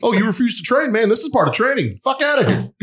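high, loud mocking drill instructor; drill sergeant. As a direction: bark like a scornful drill sergeant. Acted drill instructor yelling.

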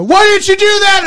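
high, loud mock yell. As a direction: yell at the TV like a fan. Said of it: "stand there and yell".

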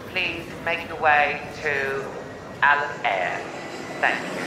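flight attendant. Pretend. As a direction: call passengers over the tannoy. Mock airport boarding announcement.

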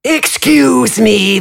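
high, slow, mannered gentleman voice; high polite interjection. Acted. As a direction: drawl the excuse politely. AJ interjects, fuller character voice.

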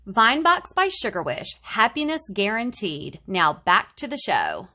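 loud, bright retro sign-off; chipper ad sign-off. Acted. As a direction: close the commercial with a smile.

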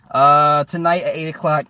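ordinary talk, nothing marked

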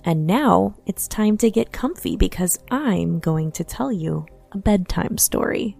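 ordinary delivery

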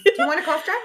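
high, bright helpful voice; sweet caring offer. Retold or imitated. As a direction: ask sweetly and concerned. Polite caring offer acted out.

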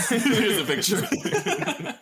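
high, laughing through the words; cracking up while speaking. Real, not acted. Cohost laughing at the bratty princess picture, laughing.

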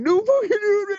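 very high crying wail. Retold or imitated. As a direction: wail in a high broken voice like a panicked kid. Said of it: "all he hears is".

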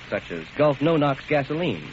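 ordinary talk

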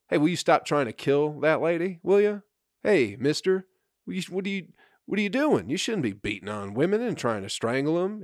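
high, pleading plea to stop; mock pleading. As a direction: beg the attacker like a timid bystander. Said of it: "he just starts, like, pleading with the guy to stop".

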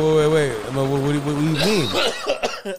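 high, loud acted confusion; flustered confusion. Friend cuts in on the caller, loudly flustered. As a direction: halt the caller, voice jumping up.

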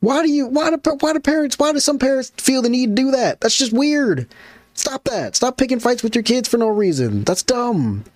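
high, indignant questions; exasperated rant. Retold or imitated. Host to strict parents, indignant. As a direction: scold the parents for picking fights. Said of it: "You'd be like".

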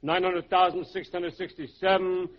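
steady sing-song count on one note; counting money. Acted. Camel dealer counting his money, monotone count. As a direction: count on evenly, absorbed in the figures. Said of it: "he's counting his money".

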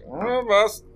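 high, whiny helper; whiny sidekick voice. Acted. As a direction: whine it like a shrugging servant. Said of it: "He's just gonna give you a shoulder shrug, like".

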